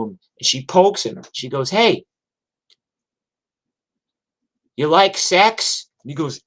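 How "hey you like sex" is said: higher-pitched mock come-on; baiting question. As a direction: lightly act out a woman baiting a drunk. Acts out Norma baiting drunk heckler.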